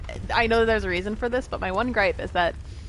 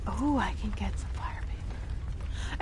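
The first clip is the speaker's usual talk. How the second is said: slow unvoiced whisper; 8 dB quieter, slower than usual. Real, not acted. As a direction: breathe it to yourself. Sudden whisper to herself.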